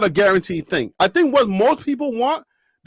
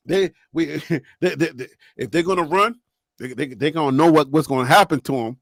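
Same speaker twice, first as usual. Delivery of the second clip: lower, stumbling Warren Sapp impression; imitating Warren Sapp; 7 semitones lower than usual. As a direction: fumble the words like Sapp did. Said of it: "Warren Sapp came on and he was like".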